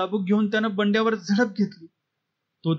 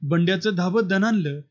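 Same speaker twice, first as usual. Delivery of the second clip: ordinary delivery, nothing marked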